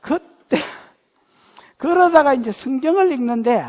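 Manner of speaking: normal talking voice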